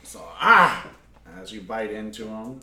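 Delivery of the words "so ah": pained cry. Acted. GM voices bitten monster.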